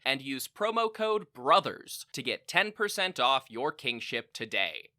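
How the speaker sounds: hammed-up infomercial announcer, slow and grand; cheesy ad pitch. Host pitches fake poison to princes, hammed-up ad read. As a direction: sell it like a tacky TV commercial.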